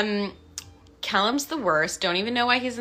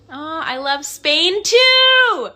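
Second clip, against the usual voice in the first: excited gush; 8 semitones higher, 8 dB louder, much slower than usual. Real, not acted. Reacting to viewer mentioning Spain.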